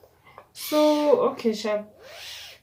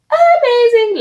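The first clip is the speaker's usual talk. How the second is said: loud high gushing praise; a loud, high, delighted rave; 13 dB louder than usual. Real, not acted. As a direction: rave about it. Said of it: "gushing about lipstick".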